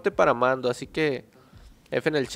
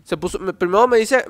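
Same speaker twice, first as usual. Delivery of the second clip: usual speaking voice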